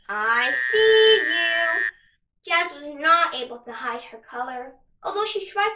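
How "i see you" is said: teasing singsong. Acted. Gecko teases, slow and loud.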